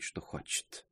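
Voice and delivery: breathed out on a sigh; aunt sighs over her reckless son-in-law. A narrator's reading. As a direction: let it go as a weary sigh. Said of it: "вздохнула госпожа Лекер".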